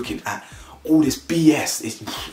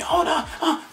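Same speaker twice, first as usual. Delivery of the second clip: very high mock gasp; mock shock. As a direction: squeal it in fake shock.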